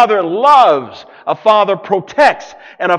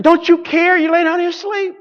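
upset disciples; 9 semitones higher than usual. Retold or imitated. Disciples rebuke sleeping Jesus.

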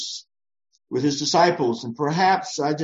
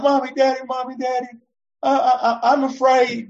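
put-on high, frightened little child calling out; a child running in from a bad dream; scared child; 11 semitones higher, 4 dB louder than usual.

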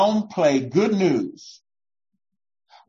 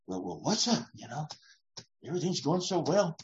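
worried muttering; 10 dB quieter, faster than usual. Retold or imitated. Retold worried self-talk.

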